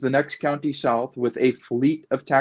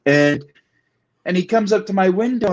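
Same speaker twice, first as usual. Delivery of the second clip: normal talking voice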